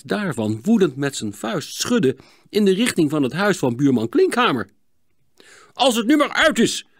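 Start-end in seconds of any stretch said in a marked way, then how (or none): 5.7-6.9 high, loud, cross outburst; angry shout. Acted. Neighbour hit by a snowball, angry shout. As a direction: shout to put a stop to it. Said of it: "riep meneer Bleker boos".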